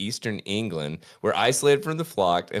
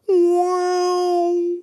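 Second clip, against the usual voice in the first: high held wow like a reversing chime; imitated car noise; 6 dB louder than usual. Host mimicking electric car reverse sound, high sustained wow. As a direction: sing out the eerie reversing sound. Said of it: "it's like this".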